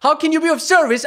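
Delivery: high, loud furious mimic of the trainer's phrase; angry mocking repeat. Real, not acted. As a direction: throw "How can you be of service?" back at him, shrill and loud. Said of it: "it's making me fucking mad".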